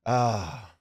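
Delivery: ordinary talk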